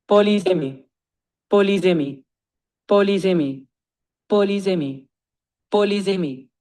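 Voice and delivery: polysemy as "poh-lee-zih-mee", repeated with even gaps; pronunciation model. Shown as a demonstration. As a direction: drill polysemy identically, with a z sound in it. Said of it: "pronounce it ten times again".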